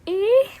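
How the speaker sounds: high, loud whiny "Eh?"; a trial sad noise, pitched high; sad whimper. Shown as a demonstration. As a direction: squeak out a sad little noise. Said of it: "what noise is that supposed to be".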